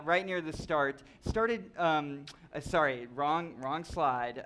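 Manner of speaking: usual speaking voice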